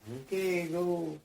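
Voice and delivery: mild call to start pushing; called-out signal. Retold or imitated. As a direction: shout the go to the crew. Crew signalling a log push.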